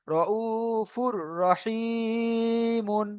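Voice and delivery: "র উ ফুর রহিমুন" slowly, vowels held level; joined recitation. Shown as a demonstration. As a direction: stretch u and i, sound "mun" ending "রহিমুন". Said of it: "আলাদাভাবে উচ্চারণ হচ্ছে".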